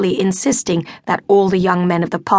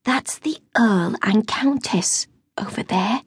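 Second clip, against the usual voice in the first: unvoiced whisper close to the ear; hushed secret whisper; 3 semitones higher, 3 dB quieter than usual. A narrator's reading. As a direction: breathe it into a friend's ear. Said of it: "put her lips close to Daisy's ear".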